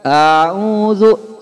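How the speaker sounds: a'udzu with a stretched opening a; wrong long a'udzu. Shown as a demonstration. Teacher showing how not to read a'udzu. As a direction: drag the a of a'udzu. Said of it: "jangan dibaca".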